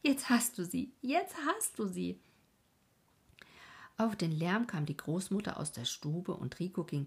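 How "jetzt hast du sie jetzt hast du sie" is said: high, excited cry of joy. Acted. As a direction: call it out, beside yourself. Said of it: "wie außer sich und rief".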